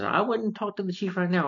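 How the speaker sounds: high-pitched imitated warning; mock warning. Baxter warns Gadget off the chief, high mock warning. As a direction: start high and slide down, lightly acted caution.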